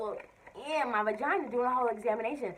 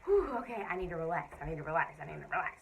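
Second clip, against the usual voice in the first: calming myself; 5 semitones lower, faster than usual. Retold or imitated. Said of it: "I'm just like".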